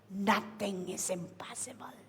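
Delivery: breathy whispered promise; hushed whisper. Acted. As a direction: drop to a whisper for effect. Whispered climax of point.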